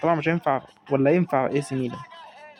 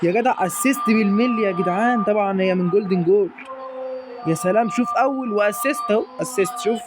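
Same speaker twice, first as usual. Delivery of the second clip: high, thrilled goal celebration; excited cheering; 6 semitones higher than usual. Real, not acted. Gamer cheering Salah's assist to viewers, high and excited.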